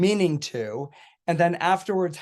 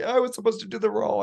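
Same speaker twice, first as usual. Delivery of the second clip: higher, quick imitation of crying; mock tearful complaint; 6 semitones higher, faster than usual. Friend who lost the role, mock tearful protest. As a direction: lightly imitate a wailing friend. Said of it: "bursts out crying".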